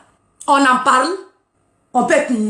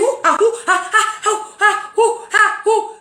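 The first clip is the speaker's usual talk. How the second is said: hammed-up high ooh-ah moans; laughing fake sex moans; mock moaning; 8 semitones higher than usual. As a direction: act out the moaning, playful and high. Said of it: "on est en train de gémir".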